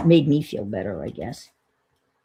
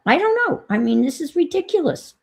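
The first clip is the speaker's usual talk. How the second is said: exasperated; 11 semitones higher than usual. Real, not acted. Venting about delayed windows.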